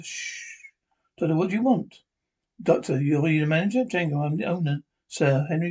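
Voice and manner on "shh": a soft unvoiced hush to silence him; whispered shush. The doctor hushing the theatre owner, whispered. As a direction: breathe the shh, barely there.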